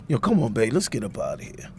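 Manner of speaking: low, soft call to leave; quiet urging. Acted. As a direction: murmur it to her, ready to go.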